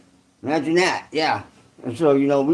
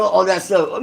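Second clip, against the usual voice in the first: ordinary delivery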